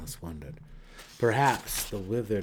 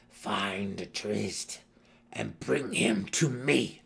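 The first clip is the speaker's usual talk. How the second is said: growled; much slower than usual. A narrator's reading. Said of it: "Her voice grating as though her mouth were filled with pebbles, she growled".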